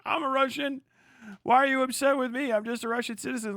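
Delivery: high-pitched mock pleading; mock wounded citizen. Russian citizen asking why you're upset, mock wounded. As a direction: plead innocence, hammed up.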